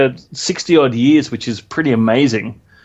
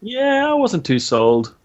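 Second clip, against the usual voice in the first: slow, higher-pitched pretend reluctance; feigned lukewarm; 8 semitones higher, slower than usual. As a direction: draw it out as mock disappointment. Feigned dislike, then admits loving it.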